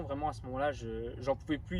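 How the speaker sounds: out of energy before eating, he trails off worn out; low on energy. Real, not acted. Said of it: "Vous avez dû le voir dans mon intonation".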